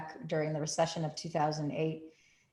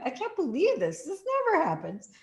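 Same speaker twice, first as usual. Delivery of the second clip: much higher, thrilled disbelief; 10 semitones higher than usual. Retold or imitated. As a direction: squeal a little with joy. Retold thrilled reaction.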